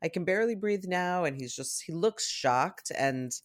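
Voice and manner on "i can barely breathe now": lightly voiced upset child; a hint of a hurt little boy. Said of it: "He's like".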